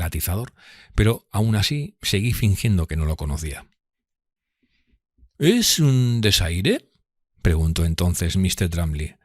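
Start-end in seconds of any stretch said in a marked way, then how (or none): 5.4-6.9 slow, pointed, higher-pitched challenge; voicing a sneering rival, slow and high; Drumley's voice. A narrator's reading.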